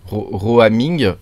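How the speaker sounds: "Roaming" drawn out slowly in an English accent; self-mocking English accent. Shown as a demonstration. Tutorial host jokes about his fine accent, saying "Roaming" in slow, showy English. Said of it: "avec mon bel accent".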